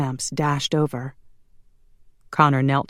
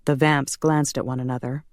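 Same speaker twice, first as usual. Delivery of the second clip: plain reading voice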